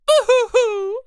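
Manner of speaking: loud cartoon boo-hoo sobs; boo-hoo crying. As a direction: wail it like a cartoon cry. Fake crying.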